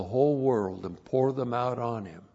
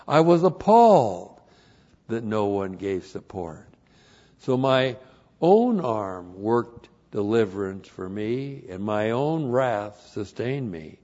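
emphatic declamation with punched opening words; scripture reading; 7 dB louder than usual. Real, not acted. Preacher reading God's words in Isaiah, forceful declamation. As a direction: thunder the key words, then ease off.

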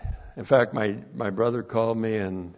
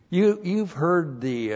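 ordinary delivery

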